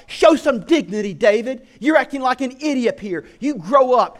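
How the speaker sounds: loud, high scolding of her husband; scornful wife. Retold or imitated. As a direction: cut him down with contempt. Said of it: "she's like".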